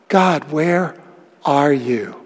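almost whispered, from the bottom of despair; hushed despair. Acted. Voicing the abandoned psalmist.

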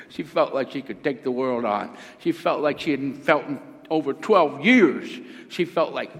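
usual speaking voice

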